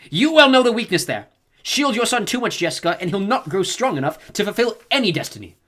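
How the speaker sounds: high, loud, biting reprimand; old woman rebuking a mother's softness; sharp scolding. A narrator's reading. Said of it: "the old woman snapped".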